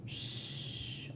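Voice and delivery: soft whispered shh; a gentle shushing sound; sleeping bear shush. Shown as a demonstration. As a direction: hush like a bear asleep. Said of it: "Let's hear him sleeping".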